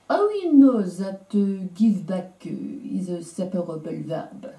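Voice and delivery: imitating a French manager puzzling over English; French accent. French manager asks about Tom's grammar, mild French accent. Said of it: "excuse my French accent".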